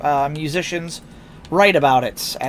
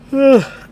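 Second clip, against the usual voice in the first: big yawning sigh; 9 semitones higher, 8 dB louder than usual. Real, not acted. Yawns mid-sentence.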